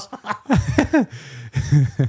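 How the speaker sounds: loud, high laughing outburst; big real laugh; burst of laughter. Real, not acted. Reacts to seven-years question.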